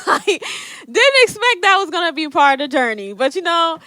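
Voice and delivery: laughing through the words; half laughing, half crying as she tells it. Real, not acted. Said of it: "It's a damn shame".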